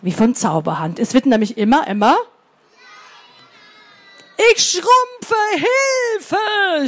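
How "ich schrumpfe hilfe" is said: much higher panicked cry for help; a squealing shriek as it shrinks; monster screaming. Acted. Monster shrinking and crying out for help, high shriek. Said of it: "schrie es".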